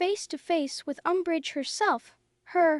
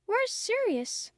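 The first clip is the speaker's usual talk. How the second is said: demanding; 7 semitones higher than usual. Acted. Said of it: "Harry demanded".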